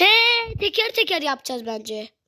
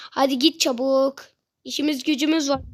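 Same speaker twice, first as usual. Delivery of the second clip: normal talking voice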